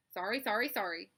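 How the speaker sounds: loud honky triple apology; sheepish gander. Acted. The gander apologises to Charlotte, honking. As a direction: apologise in quick honks. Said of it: "said the gander".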